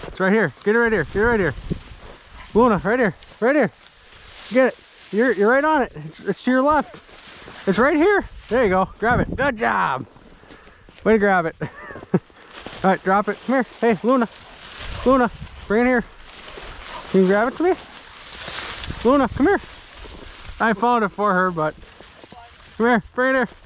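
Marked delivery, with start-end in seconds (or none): 0.1-13.7 high, sing-song praise for the dog; talking to a dog. Real, not acted. 13.8-19.6 high, coaxing dog call. Real, not acted. Calling the dog back.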